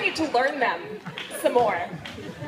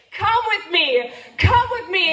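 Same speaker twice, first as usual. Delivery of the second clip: slow then leaping much higher and louder; urgent building plea; 7 semitones higher, 4 dB louder, slower than usual. Retold or imitated. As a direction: urge him on, rising to a cry. Urging lover in story.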